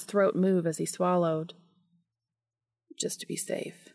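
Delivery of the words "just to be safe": breathless whispered murmur; muttered whisper. A narrator's reading. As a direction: breathe it close and low. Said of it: "Cass muttered".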